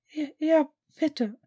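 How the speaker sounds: ordinary delivery, nothing marked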